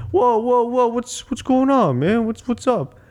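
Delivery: high, placating mock voice; Rocky imitation. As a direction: imitate a dad trying to calm things down. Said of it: "Rocky's just like".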